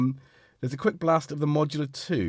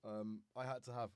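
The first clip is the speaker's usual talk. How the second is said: ordinary talk, nothing marked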